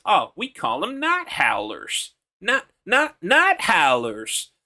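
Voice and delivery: slow southern drawl, put-on lisp. Shown as a demonstration. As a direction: stretch it in a lisping southern accent. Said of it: "almost lisp, like, put on lisp".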